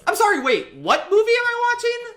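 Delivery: high-pitched, incredulous outburst; thrown-off, bewildered interruption; mock disbelief. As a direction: jump way up in pitch, baffled. Mock disbelief at film's shift.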